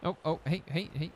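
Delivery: usual speaking voice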